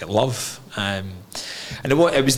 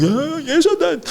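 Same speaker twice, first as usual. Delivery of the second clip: put-on high, small boy's voice pleading; child's protest; 5 dB louder than usual. Boy protesting to his angry father, high pleading. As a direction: squeak it out like a scared kid.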